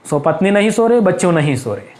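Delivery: normal talking voice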